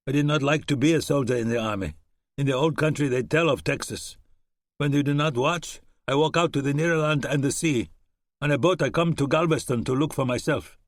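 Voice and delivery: a hint of a German soldier; German accent. German reassuring Webb about his arm, light German accent. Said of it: "a German accent".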